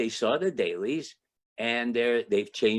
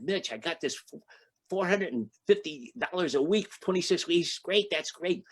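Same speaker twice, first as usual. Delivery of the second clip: higher, giddy retelling of the good news; can't believe the luck, bubbling over; thrilled call to a friend, pitch up; excited; 7 semitones higher than usual. Retold or imitated. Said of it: "I was so excited".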